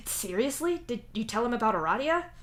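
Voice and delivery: high, disbelieving question; incredulous. Acted. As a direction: ask in disbelief. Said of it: "you ask incredulously".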